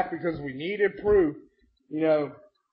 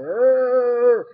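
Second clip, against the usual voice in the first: long, high, loud drone; hammed-up zombie moan; mindless groan; 6 semitones higher, 6 dB louder than usual. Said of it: "they start wandering around like".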